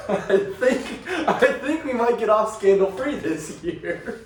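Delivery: high-pitched, talking through laughter; giggly, high, joking delivery; laughing. Real, not acted. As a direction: barely get it out for laughing. Joking about a scandal.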